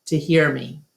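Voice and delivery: plain reading voice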